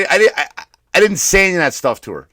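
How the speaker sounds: normal talking voice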